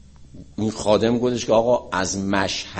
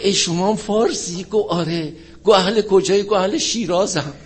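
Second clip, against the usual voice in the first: lightly acted higher-pitched dialogue; a touch of surprised recognition, high; retold surprised exchange; 8 semitones higher than usual. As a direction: act out a chance meeting, pitched up. Acted street dialogue abroad.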